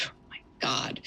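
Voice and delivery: breathless whisper of disbelief; whispered aside. Real, not acted. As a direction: drop to a whisper, stunned. Aside while reading donation sums.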